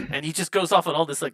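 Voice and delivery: ordinary delivery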